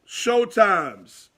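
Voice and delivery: usual speaking voice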